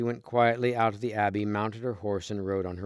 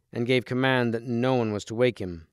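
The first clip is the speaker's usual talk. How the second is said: ordinary delivery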